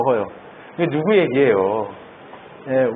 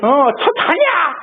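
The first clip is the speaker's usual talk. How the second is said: mock astonishment; 13 semitones higher, 4 dB louder, much slower than usual. Mock reaction of disciples.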